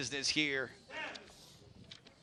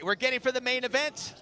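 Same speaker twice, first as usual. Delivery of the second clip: excited; 10 semitones higher, 3 dB louder than usual. Real, not acted. Hyping the main event.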